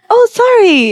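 high-pitched fake-innocent apology; mock clueless apology. Listener imagining a trespasser's reply, in a mock-innocent voice. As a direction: lightly act like a clueless rule-breaker.

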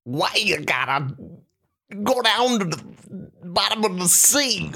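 high, slow sitcom-dad impression; Cliff Huxtable. Trying a simple fish character, sitcom dad impression. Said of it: "Cliff Huxtable there".

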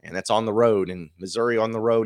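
usual speaking voice

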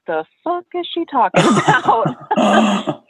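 slow, incredulous what-the-heck read; confused workers voicing disbelief at the stepmom, slowly; baffled guys. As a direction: drag it out like you can't believe her. Guys' baffled look voiced.

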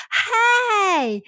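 high, drawn-out gushing Southern greeting; heavy Southern accent. Retold or imitated. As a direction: imitate a friend's thick Southern holler hello. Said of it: "much heavier Southern accent than I do".

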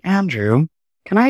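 lightly British imitation of a man; British accent. Dance supervisor approaches Andrew during tech, British accent. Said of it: "gave him a British accent".

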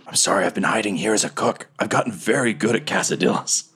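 whispered confession to an ally; hushed, covert explanation; secretive whisper. Acted. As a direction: confide it under your breath. Cook in hiding confides to captain.

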